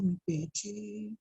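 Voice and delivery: usual speaking voice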